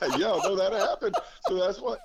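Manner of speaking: amused, laughing while conceding it; laughing agreement. Real, not acted. Coach admits to the host he deleted his number, laughing. Amused at caller's retold story.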